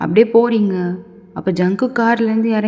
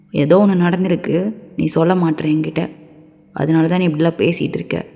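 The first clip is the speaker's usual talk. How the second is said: low-voiced man scolding and pressing; 5 semitones lower than usual. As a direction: demand the truth in a lower voice.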